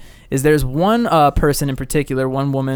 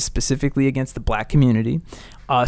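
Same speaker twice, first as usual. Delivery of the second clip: ordinary delivery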